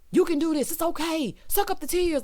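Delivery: hammed-up locker-room coach; a hint of a gruff sports coach; mock coach. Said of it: "like you're Coach Carter".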